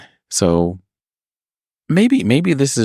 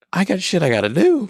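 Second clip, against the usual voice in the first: high-pitched imitation of an irate customer; mad customer; 14 semitones higher than usual. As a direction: mimic her getting worked up, voice jumping high. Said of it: "she gets mad at me".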